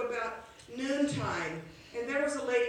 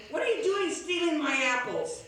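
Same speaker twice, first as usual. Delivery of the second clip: put-on higher, louder scolding yell at a boy; old lady yelling; 6 semitones higher, 5 dB louder than usual. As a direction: shout at the apple thief. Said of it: "she was yelling at him".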